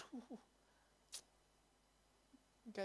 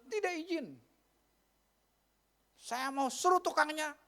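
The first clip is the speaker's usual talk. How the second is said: higher, louder grumbling neighbour; complaining resident; 7 semitones higher, 6 dB louder than usual. Retold or imitated. Resident complains to the RT head, higher and louder. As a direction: demand it like an annoyed neighbour.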